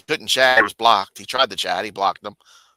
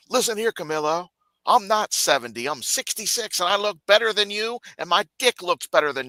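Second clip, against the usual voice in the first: higher pitched mock brag; mock boast; 9 semitones higher than usual. [name] boasting to Camillo on the panel, high mock brag. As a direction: brag it like a cocky old man.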